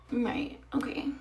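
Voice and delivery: sarcastic breathless right okay; whispered. Real, not acted. Vlogger mocking date's excuse in a whisper. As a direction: mutter it dryly to camera. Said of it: "whispered sarcastic aside".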